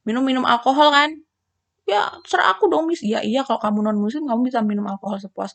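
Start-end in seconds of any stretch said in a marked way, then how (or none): 1.8-3.0 lightly voiced student, higher pitch; student talking back. Student answering back to Miss about alcohol rules, mildly defiant.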